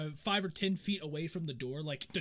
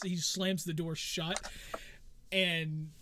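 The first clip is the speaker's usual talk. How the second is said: ordinary talk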